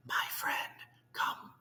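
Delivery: soft, breathy tiny-voice whisper; faint whisper. Acted. Tiny Who on the dust speck calling Horton, faint whisper. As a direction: whisper as if from far below. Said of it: "so faint he could just barely hear".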